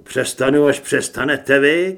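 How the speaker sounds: loud defiant retort; forceful yell at normal pitch; shouting back. A narrator's reading. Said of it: "křikl jsem zpátky".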